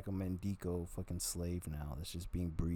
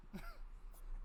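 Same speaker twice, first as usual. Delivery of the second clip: quick high giggle; 12 dB quieter than usual. Real, not acted. Short laugh.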